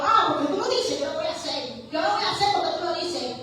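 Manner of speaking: mock defiant compliance, quick finish; sok berani. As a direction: act cocky with the Lord, grudgingly obeying. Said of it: "nos ponemos guapos con Cristo".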